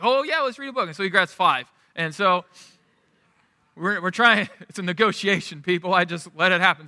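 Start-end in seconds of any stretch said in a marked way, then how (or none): 0.0-0.9 higher-pitched imitation of an excited small kid; eager child. Son agreeing to books with dad, imitated eagerness. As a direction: pipe up like a child jumping at the offer. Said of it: "He's like".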